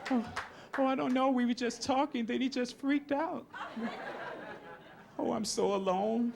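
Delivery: high-pitched put-on wife voice; innocent wife. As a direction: play the helpless new widow. Acts wife answering police over husband.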